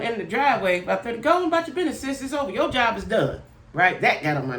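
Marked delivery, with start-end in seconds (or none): 1.2-3.4 higher, breezy wave-off, sis; mock dismissive send-off. Dismissing the tow girl from the driveway, high breezy brush-off. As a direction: shoo her away lightly, voice way up.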